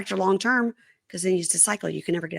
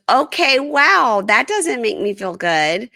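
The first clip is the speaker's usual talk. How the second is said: loud, high affronted retort, slowing at the end; affronted wife; 5 semitones higher, 6 dB louder, slower than usual. Retold or imitated. Host voicing wife insulted by husband, loudly affronted. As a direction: imitate a stung wife hitting back loudly.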